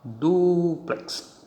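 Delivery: teacher marking the stress in Dúplex; stress shown. Shown as a demonstration. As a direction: lift DÚ-plex to show its stress. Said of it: "está aqui a tonicidade".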